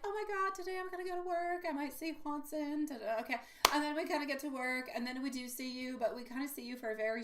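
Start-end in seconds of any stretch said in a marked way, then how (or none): 0.0-3.4 imitating a giddy, much higher voice; excited. Reader mimicking the client's excitement about work, higher giddy voice. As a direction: play the client buzzing about seeing him at work. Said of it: "You get really, really excited".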